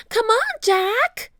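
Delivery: high, loud, drawn-out urging call; impatient high-pitched holler; shouting. A narrator's reading. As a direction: shout high to hurry a friend along. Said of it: "Annie shouted".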